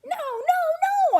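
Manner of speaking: high squeaky little protest; squeaky hamster. A narrator's reading. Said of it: "I squeaked".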